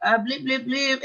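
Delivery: imitating daughter's non-answers; mocking mumbling teen. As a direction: trail off into meaningless blips like a shrugging teenager. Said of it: "But she just kind of like".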